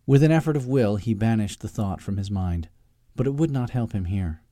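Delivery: plain reading voice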